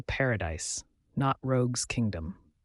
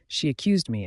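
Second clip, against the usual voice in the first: ordinary delivery, nothing marked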